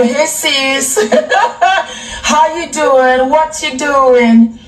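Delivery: friendly greeting to a sister. Retold or imitated. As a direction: greet her like a long-lost sister. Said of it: "When I see you".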